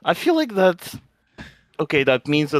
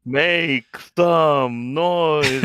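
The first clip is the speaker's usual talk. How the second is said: slow hype call; 4 semitones higher, 3 dB louder, much slower than usual. Acted.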